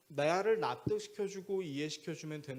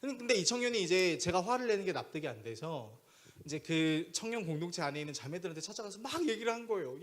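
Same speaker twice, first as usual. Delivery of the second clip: usual speaking voice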